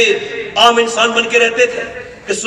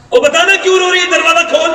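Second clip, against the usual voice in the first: high-pitched, urgent call through a door; insistent demand; 7 semitones higher, 4 dB louder than usual. Retold or imitated. Umar at a crying woman's closed door, insistent. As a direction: call out and press for an answer.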